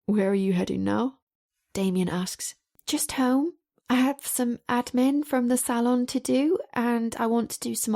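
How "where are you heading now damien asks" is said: ordinary delivery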